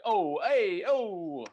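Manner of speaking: hammed-up Sopranos Italian-American gangster, starting loud and dying down. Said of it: "these vaguely Italian noises".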